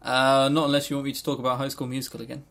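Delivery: normal talking voice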